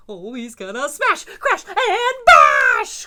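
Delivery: high, loud, slow laughing chant; excited cry. Acted. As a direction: savor every smash word. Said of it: "cried Gloom and Doom".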